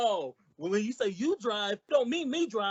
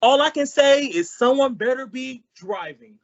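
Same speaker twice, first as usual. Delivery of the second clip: much louder, fed up; exasperated outburst; 3 semitones higher, 9 dB louder than usual. Acted. Costello exasperated with Abbott's riddle. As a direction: blurt it out in frustration.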